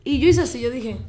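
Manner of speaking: ordinary talk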